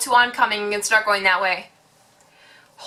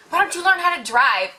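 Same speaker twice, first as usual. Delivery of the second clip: high-pitched retold comeback; indignant retort; 7 semitones higher than usual. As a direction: throw back a mock-indignant question. Boyfriend's retort in retold quarrel.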